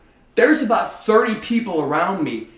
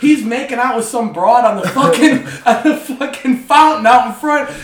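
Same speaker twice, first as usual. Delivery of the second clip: higher, louder laughing disbelief; higher and louder, laughing at the buddy's betrayal; laughing outrage; 10 semitones higher, 8 dB louder than usual. Real, not acted. Indignant retelling with laughter.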